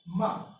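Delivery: ma high and loud, falling quickly; fourth tone. Shown as a demonstration. Teacher repeating the fourth tone, sharp falling ma. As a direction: throw ma down from a high start. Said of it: "in the fourth tone".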